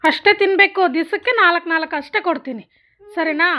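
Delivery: loud, high-pitched parental rule-setting; firm scolding. Real, not acted. Mother setting limit for crying child.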